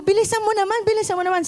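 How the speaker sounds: wife nagging husband to hurry, mildly exasperated; impatient nagging. Retold or imitated. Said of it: "Kaya sasabihin ko".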